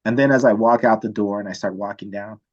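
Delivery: usual speaking voice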